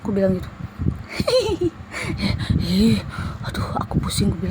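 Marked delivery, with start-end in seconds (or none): 0.6-2.6 high breathy spirit laugh, not her own voice; creepy jinn laugh; eerie whispered laugh. Retold or imitated. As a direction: laugh like a mocking ghost.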